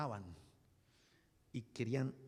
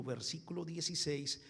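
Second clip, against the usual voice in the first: ordinary delivery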